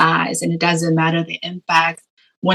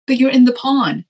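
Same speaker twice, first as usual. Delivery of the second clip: higher-pitched imitation of a consoling brother; lightly acted reality check; retold reassurance; 7 semitones higher than usual. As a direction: point out the obvious to a crying sister. Retold brother consoling crying sister.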